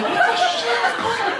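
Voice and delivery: high-pitched comic disbelief; mock bewilderment. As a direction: squeal the question in put-on confusion. Retold bewildered reaction.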